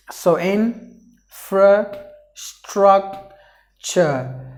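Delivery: "infrastructure" stretched very slowly, every syllable clear; slow model word. Shown as a demonstration. Teacher slowly modelling "infrastructure" for learners to repeat. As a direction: spell out "infrastructure" at a crawl. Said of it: "the letter E is silent".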